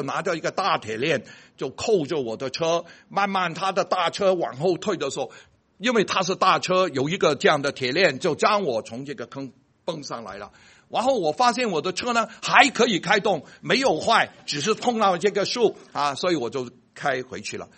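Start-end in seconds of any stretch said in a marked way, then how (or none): none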